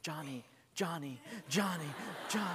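soft, dreamy, quietly repeated name; girl repeating. Retold or imitated. Little girl memorising a boy's name, soft and dreamy. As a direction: murmur the name over and over.

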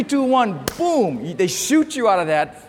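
ordinary talk, nothing marked

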